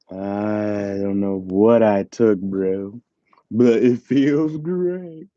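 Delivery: very slow, drawn-out pretend stoned voice; playing high. Streamer imagining what being high is like, playing high. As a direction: stretch each word as if dazed, rising at the end. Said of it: "I imagine that's what being high is like".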